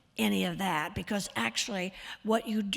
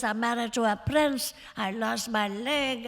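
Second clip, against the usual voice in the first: raised-pitch Hungarian socialite impression; Zsa Zsa Gabor; 3 semitones higher than usual. Shown as a demonstration. Actress mimicking a socialite describing herself, Hungarian diva impression. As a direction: imitate a Hungarian diva, voice lifted. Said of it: "she talks like this".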